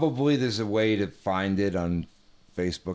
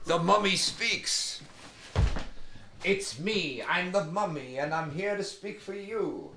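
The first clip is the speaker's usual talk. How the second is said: mummy voice; 7 semitones higher than usual. Pretend. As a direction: intone like an ancient mummy risen from the tomb.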